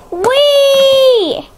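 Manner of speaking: long, high squeal of glee; gleeful whee. Pretend. Child voices a toy in flight, gleeful whee. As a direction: play a toy whooshing along, high. Toy sliding in pretend play.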